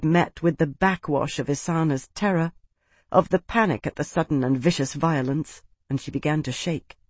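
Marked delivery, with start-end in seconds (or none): none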